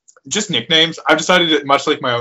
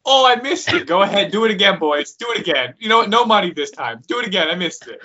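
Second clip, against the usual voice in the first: mock heckler; 8 semitones higher than usual. Playful heckling, demanding an encore.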